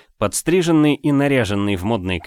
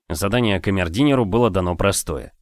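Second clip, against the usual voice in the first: plain reading voice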